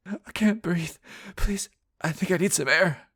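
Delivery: high, breathless panic; panicked outburst. Acted. Peggy panicking in the car, high and breathless. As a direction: gasp for air in fear. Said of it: "Peggy's outburst".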